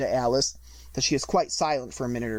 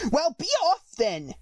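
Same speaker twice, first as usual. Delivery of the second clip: sulky; 12 semitones higher than usual. A narrator's reading. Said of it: "in a sulky tone".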